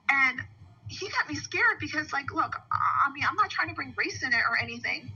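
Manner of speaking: normal talking voice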